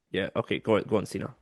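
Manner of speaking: normal talking voice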